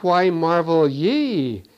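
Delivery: "ye" drawn out long and slow; slow right down and stretch "ye"; stress on YE. Real, not acted. Preacher quoting Peter to the crowd, stress on "ye". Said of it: "the word ye is very important".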